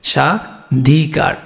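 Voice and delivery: স্বাধিকার broken slowly into sha, dhi-kar; drawn-out teaching demo of স্বাধিকার with a silent ব; syllable by syllable. Shown as a demonstration. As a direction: sound out স্বাধিকার in slow, separated pieces. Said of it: "তালব্য শ হবে".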